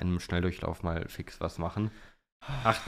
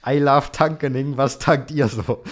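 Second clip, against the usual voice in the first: quoted ad caption; 5 semitones higher, 7 dB louder, slower than usual. Retold or imitated. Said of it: "hat zugeschrieben".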